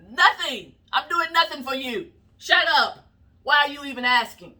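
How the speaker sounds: mock irritated Biden. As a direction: bark it out like a fed-up boss. Voicing Biden irritated, dismissive.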